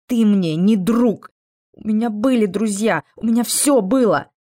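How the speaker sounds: heated, high-pitched protest; angry outburst. Acted. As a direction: hurl the accusation at them. Girl's outburst at her captor.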